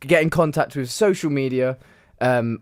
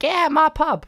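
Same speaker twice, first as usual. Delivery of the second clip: much higher soap landlady voice; high-pitched cry of a proud publican; pub landlady; 12 semitones higher, slower than usual. Acted. Co-host imitates soap's older pub landlady, mock outburst. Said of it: "she's like".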